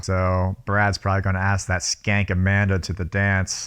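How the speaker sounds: ordinary talk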